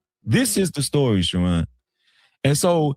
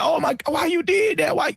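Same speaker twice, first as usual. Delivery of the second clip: high whiny mock complaint; mocking a friend who whined about his wife; whining; 11 semitones higher than usual. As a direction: imitate a man whining, pitched higher. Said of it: "he was whining".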